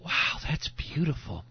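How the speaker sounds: soft, hushed little wow of admiration; mock admiration. Preacher voicing onlookers at a sacrifice, softly admiring. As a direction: say it softly, as if touched by the sight. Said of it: "We usually go".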